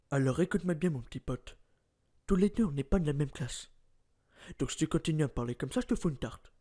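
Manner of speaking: low quiet threat to a little punk; menacing tough guy. Acted. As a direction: tough guy warning someone he'll slap him. Same threat, third take.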